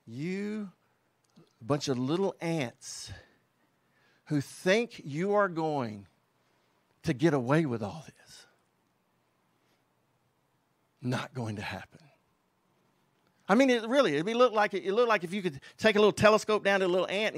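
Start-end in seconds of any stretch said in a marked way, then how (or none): 0.1-12.0 a hint of God sneering down at ants; mocking God. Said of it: "laughing at them in a mocking way".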